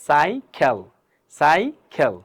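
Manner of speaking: সাইকেল in two slow parts, "sai, khel"; slow সাইকেল with a pause before "kel"; pronunciation model. Shown as a demonstration. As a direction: say সাইকেল syllable by syllable. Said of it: "উচ্চারণ করার সময় কি করবেন?".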